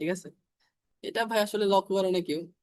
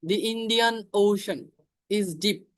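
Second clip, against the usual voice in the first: drawn out, with "The" said as "দি"; teacher modelling the article; slow example; much slower than usual. Shown as a demonstration. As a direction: say "The Indian Ocean" slowly, with "The" as "দি". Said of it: "দি বসবে".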